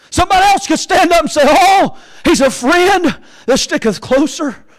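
high, loud, sustained preacher's cry; full-force preaching. Acted. Preacher calls out to the dying thief, full-force preaching. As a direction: preach it in long, ringing phrases.

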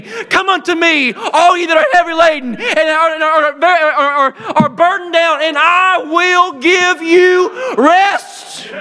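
stretched, soaring pulpit cry; preaching climax. Acted. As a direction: call out the invitation, drawing it out.

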